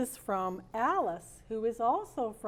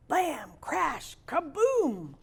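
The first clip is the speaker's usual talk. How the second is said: loud, higher crash effects; crash sounds; 5 semitones higher, 3 dB louder, much slower than usual. Acted. The story's opening crash, voiced as loud, higher sound effects. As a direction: bang them out like a crash.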